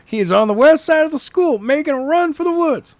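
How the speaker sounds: high, urgent report over the radio. Acted. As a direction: report the sighting, pitched high.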